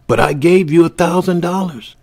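high, loud, exasperated outburst; desperate shout at the man who took his money; lost patience. A narrator's reading. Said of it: "Peter had lost his patience".

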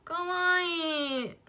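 very slow, drawn-out doting かわいい; a slow, cooing gush at a cute animal. Real, not acted. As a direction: melt over it, stretching every sound.

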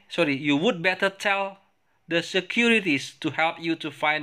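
high, slow, careful English reading voice; reading both parts for the class to copy; English dialogue read aloud. Shown as a demonstration. Reads second dialogue aloud. (membaca dialog bahasa Inggris dengan nada tinggi dan pelan)

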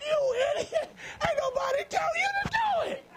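a high laughing scolding yell; laughing self-scold. Acted. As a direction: shout at yourself while cracking up.

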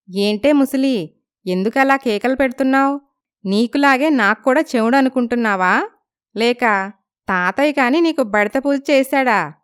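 mock-scold the old lady playfully; cheeky young girl. Lalitha teases the neighbour grandma, high cheeky voice.

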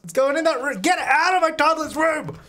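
high, outraged shouts; exasperated yelling. Real, not acted. Player orders mailman out of house, high exasperated yelling. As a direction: order them out in a high yell.

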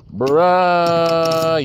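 long exasperated bruh; drawn-out bruh. Real, not acted. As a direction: stretch the bruh out in disbelief. Second reaction, drawn out.

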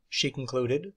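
plain reading voice

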